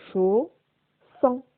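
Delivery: "chaud, sang" as two separated syllables of one word. Shown as a demonstration. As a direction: sound out "chaud, sang" with a pause between. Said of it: "dans la première syllabe". (« chaud, sang » détaché syllabe par syllabe)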